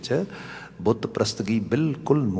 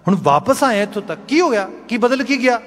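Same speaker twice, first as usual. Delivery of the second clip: high, insistent questioning; acted bewilderment, pitched sharply up; astonished; 6 dB louder than usual. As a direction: demand to know what changed, amazed. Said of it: "ਹੁਣ ਸ਼ਾਹੂਕਾਰ ਬੜਾ ਹੈਰਾਨ ਹੋਇਆ".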